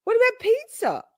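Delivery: high-pitched disbelieving question; mock alarm. Testing friend's dislike of cheese, mock alarmed. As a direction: squeak the question in playful panic.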